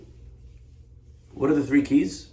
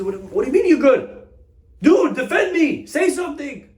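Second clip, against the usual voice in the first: high, loud desperate begging; a man pleading for his life, voice cracking high; panicked plea; 14 semitones higher, 4 dB louder than usual. Acted. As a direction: defendant pleading with silent lawyer, frantic high cry. Defendant begs lawyer to speak.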